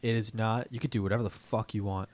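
ordinary talk, nothing marked

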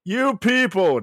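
"You people." mocked, old-timer style; mock old man. Shown as a demonstration. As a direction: bark "You people." like a cranky grandpa. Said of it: "who are you, like, ninety-three?".

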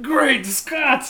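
high, loud movie-scientist cry; mock Doc Brown exclamation. Riffing on Doc Brown in Klingon costume, hammed-up cry. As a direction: blurt it as an over-excited impression.

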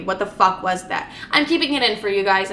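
ordinary talk

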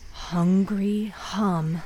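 plain reading voice

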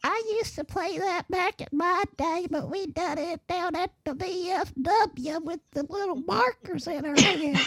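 put-on very high, soft little old lady voice. Mocking a bingo ad, a high soft old lady voice. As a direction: quaver it like a granny at bingo. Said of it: "What eighty-year-old is gonna see this and go".

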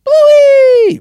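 very high, loud shouted name; cartoon call. Friend shouting the cartoon name at the screen. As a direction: shriek Bluey like the theme. Singing Bluey theme.

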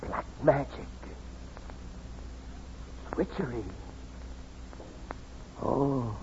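a frightened soldier staring at the bright star, hushed; fearful hush. Acted. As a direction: breathe it in dread. Awed by the falling star.

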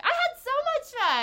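high, loud, overdone enthusiasm; gushing mock cheer. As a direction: gush it as if covering up a bad night. Acting out fake next-day cheer.

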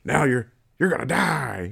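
Santa Claus voice. Retold or imitated. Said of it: "that's his voice".

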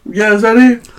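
high, flat cashier voice; imitating a drive-thru worker; bored fast food cashier. Voicing the cashier.

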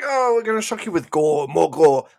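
high, loud sarcastic showman voice; mocking shock hype. Sneering at a gory show, in mock hype. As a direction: ham up a cheap horror pitch.

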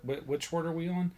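usual speaking voice

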